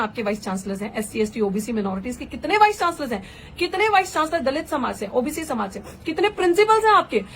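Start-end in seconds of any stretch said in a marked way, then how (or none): 6.1-7.2 raised challenging voice. Real, not acted. Rising rhetorical challenge in speech.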